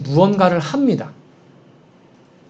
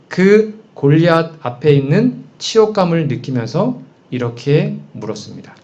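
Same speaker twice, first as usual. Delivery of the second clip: normal talking voice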